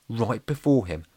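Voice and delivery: ordinary delivery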